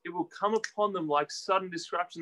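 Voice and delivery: ordinary talk, nothing marked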